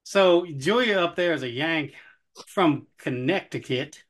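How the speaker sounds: slow, put-on Southern twang. Host introducing co-host as a Yank, slow Southern twang. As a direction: stretch it out like a Southerner. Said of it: "I can hold that accent".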